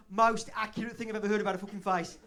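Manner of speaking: loud imitation of a stunned listener; hammed-up, forceful verdict; mock astonishment. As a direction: blurt it out as if scandalized. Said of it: "You just put your hand over your mouth like".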